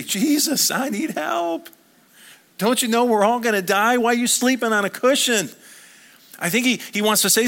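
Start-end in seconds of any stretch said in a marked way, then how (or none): 0.0-5.5 high, loud plea in a panic; panicked cry. Acted. Disciples waking Jesus in the storm, panicked cry. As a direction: cry out for help, voice pitched way up.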